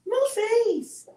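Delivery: very high squeal. Real, not acted. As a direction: squeal his name to the dog. Cooing at the dog.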